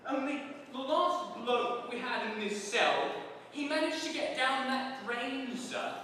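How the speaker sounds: hammed-up cockney warder; gloating warder. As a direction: crow it like a smug jailer. Warder teasing Moist.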